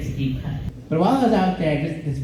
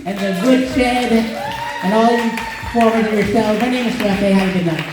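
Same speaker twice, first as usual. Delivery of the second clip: emcee shout-out; 8 semitones higher than usual. Real, not acted. Host calling out thanks to the crowd and venue.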